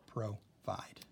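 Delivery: "provide" as pro, pause, vide; broken into syllables. Shown as a demonstration. Teacher marking syllables with dashes, word split. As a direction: say "provide" in two separate beats. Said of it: "where the syllable is".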